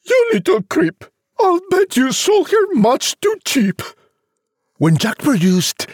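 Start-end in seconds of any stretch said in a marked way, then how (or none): 0.0-3.8 very high, scolding mum voice; high-pitched, contemptuous mother character; scornful mother. Acted. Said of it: "The mother said".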